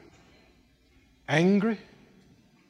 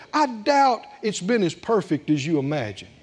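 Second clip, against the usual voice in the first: ordinary talk, nothing marked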